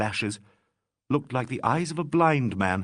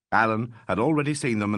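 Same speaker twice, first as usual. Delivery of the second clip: ordinary delivery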